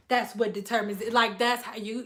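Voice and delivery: ordinary delivery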